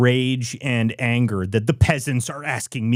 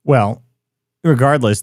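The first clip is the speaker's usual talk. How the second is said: ordinary talk, nothing marked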